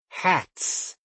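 put-on high breathy child cry of delight; excited child. A narrator's reading. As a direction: squeal it like a little girl. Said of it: "Dorothy cried".

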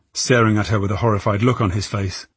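usual speaking voice

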